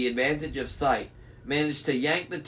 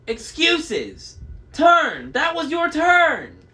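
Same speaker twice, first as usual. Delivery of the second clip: high, loud bickering; quarrelsome hag; 8 semitones higher, 6 dB louder, slower than usual. A narrator's reading. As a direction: squabble loudly with your sister, pitched high. Sisters squabbling over the eye.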